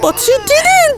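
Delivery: very high, crying voice; anguished, high-pitched reproach; tearful accusation. Acted. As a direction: sob the words out. Ghost accuses through her tears.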